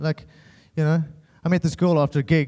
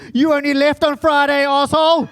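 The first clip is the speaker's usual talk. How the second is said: loud, high comic outburst; yelled retort to a ridiculous brother; exasperated shout; 8 semitones higher, 5 dB louder than usual. Retold or imitated. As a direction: blow up at him in mock outrage.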